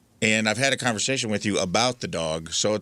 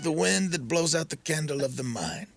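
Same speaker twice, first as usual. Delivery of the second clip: higher and slow, a sage teaching a lesson; mock wise master; 7 semitones higher, slower than usual. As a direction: deliver it like a serene guru. Mimics the neighbor lecturing him.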